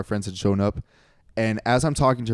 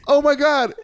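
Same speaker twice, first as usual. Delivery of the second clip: gushing high-pitched, louder exclamation; mock an over-excited friend's arrival; Carlos; 5 dB louder than usual. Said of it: "Carlos walks in and goes".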